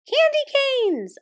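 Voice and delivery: very high, slow squeak; high squeaking mouse general; squeaky mouse voice. Acted. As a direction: squeal it like a tiny angry mouse. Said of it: "a voice squeaked".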